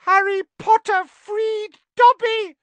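high, loud squeaky elf cry; shrill excited elf; shrill house elf. Acted. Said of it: "said the elf shrilly".

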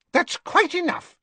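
high, snapped rebuke; sharp Madame Malkin. A narrator's reading. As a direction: cut them off sharply. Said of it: "said Madame Malkin sharply".

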